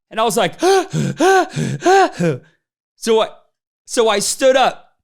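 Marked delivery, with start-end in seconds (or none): 0.6-2.4 sharp high gasp of shock; high gasp of disbelief; startled gasp. Retold or imitated. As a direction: gasp as if caught out. Shocked at being called out.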